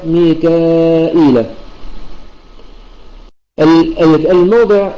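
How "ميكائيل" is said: slow "ميكائيل" with hamza and ya both sounded; ميكائيل بإثبات الهمزة والياء; reading of the rest. Shown as a demonstration. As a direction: draw out "ميكائيل" in full, every sound clear. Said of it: "وقراءة البقية".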